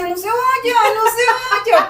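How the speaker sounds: panicked high shouting plea; desperate pleading. Retold or imitated. A paralysed daughter begging her mother not to go, panicked and shouting. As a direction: beg her not to leave, in terror. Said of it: "era una cosa desesperante".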